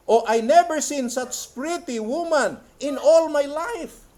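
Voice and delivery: high, loud, slow exclamation; impassioned quote reading. Acted. Preacher quoting wonder at women in the vision, impassioned exclamation. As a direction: proclaim it with wonder, stretching the words. Reads quoted testimony aloud.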